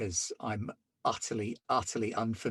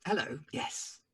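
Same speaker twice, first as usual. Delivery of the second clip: flustered gentleman; 5 semitones higher, slower than usual. Acted. Player flustered in character.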